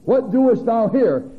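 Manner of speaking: loud, high, slow booming question; voice of God. Acted. Preacher voicing God to the prophet, loud and slow. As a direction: boom it out as God calling down. God's question quoted with force.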